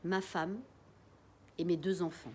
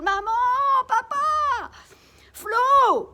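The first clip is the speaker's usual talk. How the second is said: high, very loud scream for help; 14 dB louder, much slower than usual. Acted. Girl screaming up the stairs for her family in panic. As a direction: scream for the family at full force. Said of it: "je hurle".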